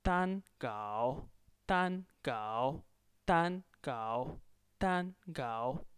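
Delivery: measured repeat-after-me "Tan gao"; tone drill. Shown as a demonstration. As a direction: hold "Tan" flat, scoop down and up on "gao". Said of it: "fourth tone and first tone".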